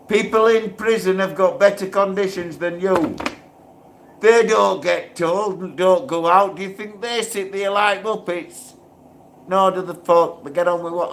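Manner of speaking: getting worked up at the unfairness; angry outburst at prison rules; irate. Real, not acted. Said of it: "you see how quickly I get irate".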